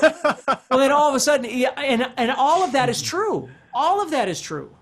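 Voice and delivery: high and loud, laughing as he speaks; laughing excitedly. Real, not acted. Teacher laughing at his own runaway logic, excited and loud. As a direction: burst out laughing, then agree, still lifted.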